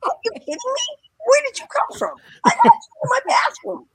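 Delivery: high, loud, laughing disbelief; astonished disbelief. Retold or imitated. [name] finding a fan in his bathroom, high astonished. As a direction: play him stunned and delighted at once.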